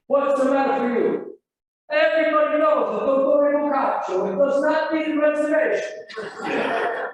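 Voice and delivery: loud, high indignant Italian host; indignant maître d'. Retold or imitated. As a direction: scold him like an offended headwaiter. Manager scolding the poor man.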